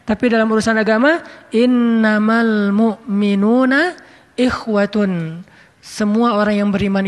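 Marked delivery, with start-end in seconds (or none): 1.5-5.4 slow, drawn-out Quranic chant; measured Arabic recitation, every syllable stretched. Acted. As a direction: intone the verse slowly and reverently. Quoting a Quran verse.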